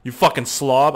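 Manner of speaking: playful fake anger at a messy eater; mock scolding. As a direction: scold her like a parent, in jest. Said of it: "I'm just kidding".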